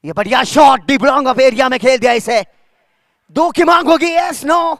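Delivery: loud and high, quick calls; excited cricket commentary. Real, not acted. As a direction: call the shot and the runs. Big shot, calling runs.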